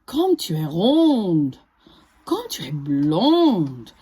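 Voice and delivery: slow, low honeyed flattery; crafty fox sweet-talking; sly fox flattery. Acted. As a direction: flatter her slowly to trap her.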